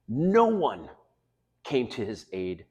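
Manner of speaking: ordinary talk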